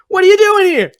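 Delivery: loud, high hammy movie line; over-the-top actor. Retold or imitated. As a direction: overact it like a bad sitcom. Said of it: "it's more like".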